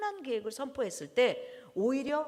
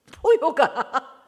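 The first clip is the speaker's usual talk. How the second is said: slow, loud, roaring word; drawn-out lion's roar; 5 semitones higher, 13 dB louder, much slower than usual. Shown as a demonstration. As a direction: roar it like the lion of Judah. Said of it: "유다의 사자와 같은 포효".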